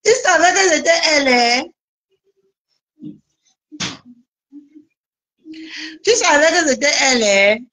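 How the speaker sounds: high-pitched needling of a rival; gloating taunt. Real, not acted. Gloats at rival after laughing. (provocation moqueuse, voix haut perchée)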